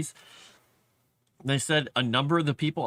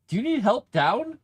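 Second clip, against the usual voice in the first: pet voice; 9 semitones higher than usual. Real, not acted. Asking cat on the ladder.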